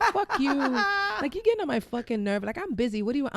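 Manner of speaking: imitating an irritated sister, high-pitched; mock annoyance. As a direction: retell her fed-up snapping, half amused. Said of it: "she gets annoyed by me".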